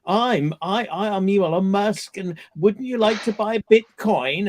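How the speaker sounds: mocking imitation of a scam advert's American voice; fake Elon Musk, American accent. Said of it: "in a really weird American accent going".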